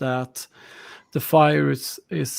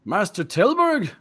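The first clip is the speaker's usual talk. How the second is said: laughing scorn, higher; mocking laugh; 6 semitones higher than usual. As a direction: laugh her claim off. Villager mocks her.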